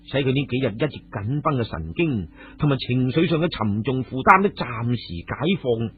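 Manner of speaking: ordinary delivery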